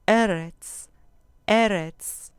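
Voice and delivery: "eretz" with a loud stressed first E; stress on penultimate syllable. Shown as a demonstration. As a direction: punch the first syllable of "eretz", let retz fall. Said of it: "con acento en la penúltima sílaba". ("eretz" con acento fuerte en la penúltima sílaba)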